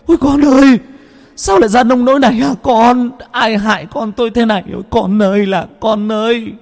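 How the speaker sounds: put-on high, loud grieving wail for her child; mother bursting into a keening cry. A narrator's reading. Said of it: "bỗng nhiên òa khóc". (mẹ già òa khóc gào gọi con)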